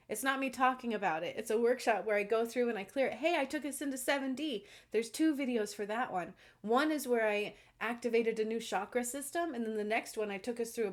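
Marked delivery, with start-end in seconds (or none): 3.1-4.6 eager pitch. Retold or imitated. Telling viewers about her video, keen and higher. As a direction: pitch it to a stranger with eager cheer. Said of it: "I will literally tell you".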